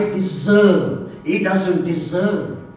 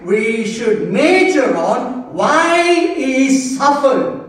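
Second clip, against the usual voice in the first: forceful preaching; 5 semitones higher, 3 dB louder than usual. Real, not acted. Preacher telling church what to major on, forceful preaching. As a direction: preach it with rising, insistent emphasis.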